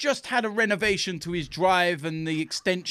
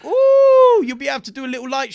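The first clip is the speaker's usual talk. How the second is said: sarcastic ooh, much higher and louder; a hammed-up fake-impressed coo; mock ooh; 5 semitones higher, 8 dB louder than usual. Mocking the rival stadium's lights, fake-impressed and sarcastic.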